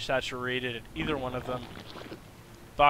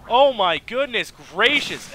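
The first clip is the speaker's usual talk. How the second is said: awed exclamation; 7 semitones higher, 8 dB louder, slower than usual. Real, not acted. Awed exclamation after a fight.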